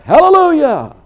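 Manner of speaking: high, very loud outburst of joy; hollered praise. Acted. Old farmer overcome while reading his Bible, hollering. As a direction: holler it out like a sudden burst of praise. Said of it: "he hollers out".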